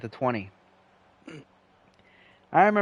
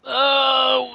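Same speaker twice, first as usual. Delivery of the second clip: startled oh; 12 semitones higher, 5 dB louder than usual. Real, not acted. Startled while moving computer.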